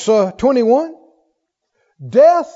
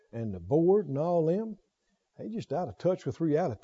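much softer, lower complaining; grumbling coworker; 7 semitones lower, 9 dB quieter than usual. Retold or imitated. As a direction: gripe about the bosses under your breath. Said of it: "Do people talk like this".

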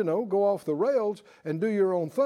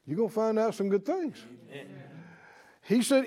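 ordinary delivery

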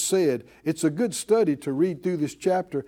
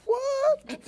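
very high, comic squeak of disbelief; astonished. Acted. David hears of the reward for fighting, astonished. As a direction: yelp it like you can't believe your luck.

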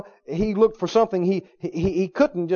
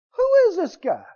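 scornful challenge; 13 semitones higher, 6 dB louder than usual. Acted. Voicing David scorning the giant.

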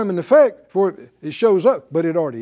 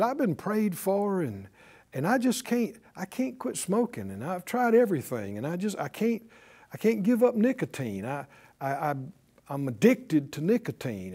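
soft, halting imitated confession; subdued, stumbling mock admission; sheepish; 8 dB quieter than usual. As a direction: play a defeated smoker owning up quietly. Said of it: "he came up kind of sheepishly".

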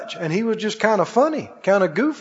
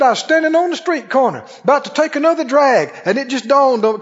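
higher, eager testimony; retold excitement; 6 semitones higher, 3 dB louder than usual. Changed man recounting his breakthrough to the preacher, excited. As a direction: tell it eagerly, voice lifted. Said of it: "He was lit up".

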